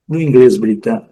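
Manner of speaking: ordinary talk, nothing marked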